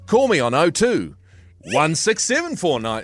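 oh two, then one six seven four nine; oddly grouped digits. Shown as a demonstration. Host showing people giving phone numbers with odd gaps. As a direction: pause after oh two, run the rest together. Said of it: "put all the gaps in the wrong place".

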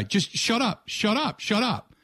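high-pitched repeated mock shout of shut up; retold shout. Host shouting down a telemarketer who won't stop talking, high mock yell. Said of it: "you're screaming at the guy".

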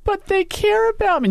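high-pitched mock whine; squeaky, self-mocking pleading; plaintive protest. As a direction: plead like a hammed-up wounded child. Acting out her tearful protest.

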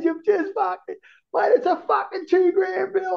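high-pitched, shouted complaint about the bill; high mock shout, half joking; mock outraged shout. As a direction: yelp it like a mate caught with the tab.

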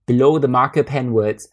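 plain reading voice

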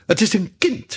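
high, eager Hare; excited high-pitched reply; enthusiastic. A narrator's reading. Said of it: "reageerde Haas enthousiast".

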